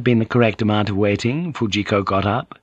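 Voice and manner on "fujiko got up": ordinary delivery, nothing marked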